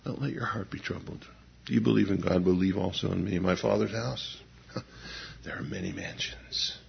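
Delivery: soft, hushed reassurance; hushed tender Jesus. Acted. Preacher softly voicing Jesus to troubled disciples, hushed.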